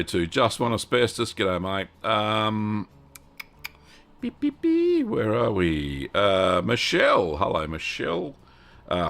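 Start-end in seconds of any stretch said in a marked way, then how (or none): 4.2-5.1 high squeaky beep beep beep; a quick silly beep sound effect; playful beeping. Pretend. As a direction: mimic an electronic beeper, way up high. Mimicking a beeping alarm.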